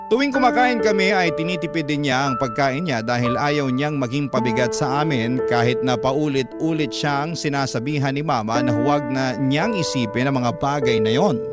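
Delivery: normal talking voice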